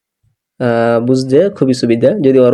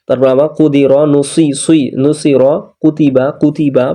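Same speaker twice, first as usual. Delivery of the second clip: ordinary delivery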